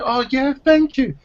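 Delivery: very high, mocking sugary thank-you; mock woman's thanks. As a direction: squeak the thanks like a caricatured woman. Woman accepting help, mocked.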